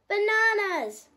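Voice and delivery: loud, high, slow exclamation; whuffed. A narrator's reading. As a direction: burst out with it. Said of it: "whuffed Herman".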